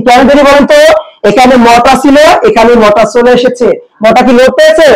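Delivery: ordinary talk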